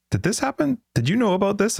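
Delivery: high startled questions; surprised brother. Retold or imitated. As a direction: ask it in a shocked high voice. Brother's startled message, quoted.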